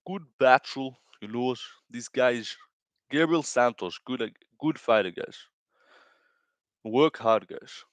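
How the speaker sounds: put-on Brazilian Portuguese accent in English; halting Brazilian-accented fighter talk; Pantoja impression. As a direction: put on a Brazilian accent, pausing between phrases. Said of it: "It's my Pantoja impression".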